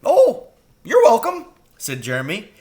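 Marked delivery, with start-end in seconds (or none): 0.0-1.5 high, quick crow voice; eager young crow. A narrator's reading. Jeremy the crow urging Mrs Frisby home, high and anxious.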